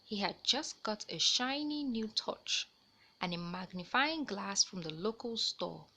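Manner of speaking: put-on lively read-aloud voice for kids; animated children's story reading; storybook narration. A narrator's reading. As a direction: tell it like a bedtime story.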